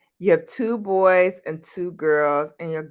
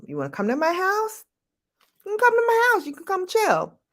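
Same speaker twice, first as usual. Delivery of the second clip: mock welcoming invite; 14 semitones higher, faster than usual. Inviting friends home, mock welcoming.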